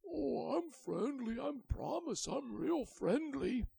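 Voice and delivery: high, slow reassuring voice; eager dragon. Acted. The dragon assuring Willy, high and slow. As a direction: promise it sweetly and slowly.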